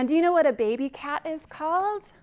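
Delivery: plain reading voice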